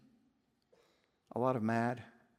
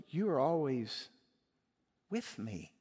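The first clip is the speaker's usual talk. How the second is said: loving father; 4 dB quieter than usual. Acted. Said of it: "the father could lovingly respond".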